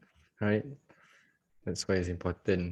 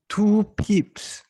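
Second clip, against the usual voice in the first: mock shocked exclaim; 12 semitones higher, 8 dB louder than usual. Repeats stop size in mock shock.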